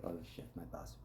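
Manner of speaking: whispered oh-no to oneself; hushed aside. Retold or imitated. Organizer realizing the boss is involved, whispered aside. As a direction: drop to a tiny unvoiced whisper. Retelling a panicked aside to himself.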